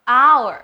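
HOUR as "ow-er", loud with a fall; silent H. Shown as a demonstration. Teacher showing only the last letters are read, silent H. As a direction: say "hour" firmly, dropping the H. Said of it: "เราอ่านแค่สามคำข้างหลังนี้".

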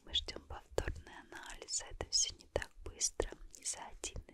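ordinary delivery

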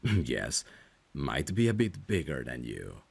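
usual speaking voice

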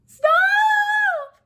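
long, very high shout; piercing, drawn-out mouse shriek; desperate cry. A narrator's reading. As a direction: scream it out and hold it. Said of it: "cried the mouse".

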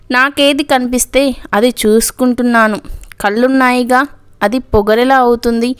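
usual speaking voice

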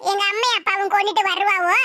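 ordinary talk, nothing marked

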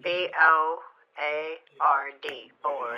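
B O A R D, slow low letters, then board; spelling it out. Shown as a demonstration. Caller spelling board for the agent, slow deliberate correction. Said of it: "It's pronounced board".

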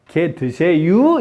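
slow, fully spelled-out polite question; drawn-out standard speech. Shown as a demonstration. Teacher showing what dialect speakers skip, slow standard question. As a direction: stretch out the long polite version. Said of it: "이런 말 안 하면".

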